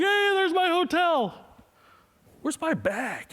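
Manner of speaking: high, bright, then dropping in alarm; cheer, then catch the missing bag; sudden realization. Retold or imitated.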